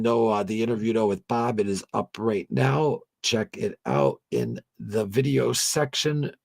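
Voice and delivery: upbeat announcer wrap-up; host sign off. Acted. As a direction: hype the close to the folks. Signing off to viewers.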